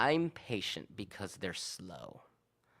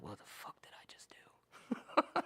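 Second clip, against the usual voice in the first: slow, whispered disbelief; hushed, breathy self-questioning; stunned whisper to self; 6 dB quieter, slower than usual. Retold or imitated. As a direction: whisper it slowly, appalled at yourself. Stunned self-talk alone in van.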